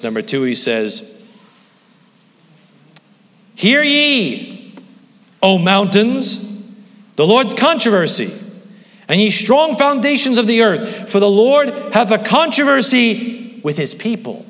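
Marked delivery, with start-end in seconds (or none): 3.5-14.4 high, loud, drawn-out proclamation; intoned scripture. Acted. Preacher proclaiming God's words to the mountains. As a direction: ring out the prophet's words.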